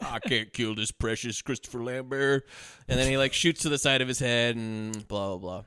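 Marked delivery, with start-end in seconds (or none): 0.0-2.5 slow put-on voice, tenderly hammed up; mock melted reluctance. Gunman facing doe eyes, slow mock tender reluctance. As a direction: go helplessly soft-hearted and slow.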